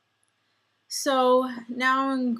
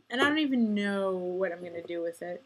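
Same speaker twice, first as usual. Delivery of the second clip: ordinary delivery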